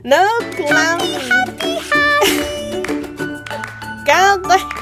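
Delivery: high loud exclamation; excited outburst. Real, not acted. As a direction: shout it in disbelief. Reacting to clown gift.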